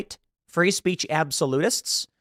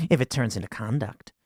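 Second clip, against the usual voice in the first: lower, soft mock of her smiling reply; imitating a smiling dean; 6 semitones lower, 5 dB quieter than usual. Mocking the university president on camera, quiet smiling mimicry. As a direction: mimic her smug little smile as you say it. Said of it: "smile, and go".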